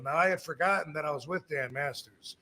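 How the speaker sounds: usual speaking voice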